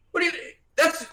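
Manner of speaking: high, loud outburst with a laugh in it; indignant shout. Acted. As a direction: snap back in disbelief. NPC disputes ownership of cart.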